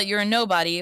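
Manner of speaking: ordinary delivery, nothing marked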